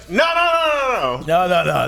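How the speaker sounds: high, loud run of protest; mock panicked denial. Woman at the show denying it to comic, mock panic. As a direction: blurt it out loudly, imitating frantic backpedalling.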